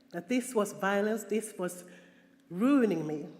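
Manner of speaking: normal talking voice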